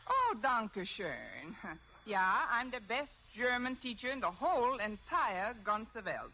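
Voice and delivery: boastful comic German teacher; fake German accent. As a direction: brag it out in a put-on German accent. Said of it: "If I can do a decent German accent".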